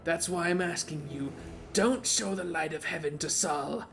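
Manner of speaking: lightly raspy witch voice; a hint of a raspy old witch. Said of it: "She sounded like a witch".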